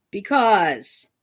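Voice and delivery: ordinary talk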